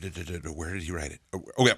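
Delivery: ordinary talk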